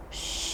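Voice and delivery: a long soft shush; a quiet shush for the little ones. Shown as a demonstration. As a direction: hush the room down. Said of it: "hushing the children".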